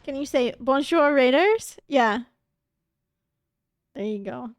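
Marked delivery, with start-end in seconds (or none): 0.6-1.8 loud French-accented greeting, rising at the end. Shown as a demonstration. Streamer practises greeting the raiders, best French accent. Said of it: "That is my best French accent".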